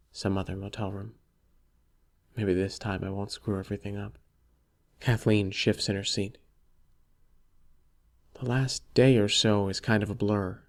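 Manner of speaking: ordinary delivery, nothing marked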